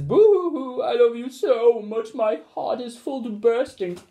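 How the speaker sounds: high, loud boo-hoo sobbing; blubbering happy tears. A narrator's reading. Father blubbers happy tears to his son, high and loud. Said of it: "burst into floods of tears".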